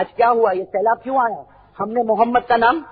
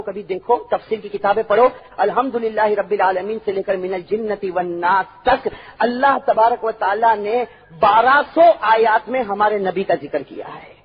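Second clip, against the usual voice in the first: usual speaking voice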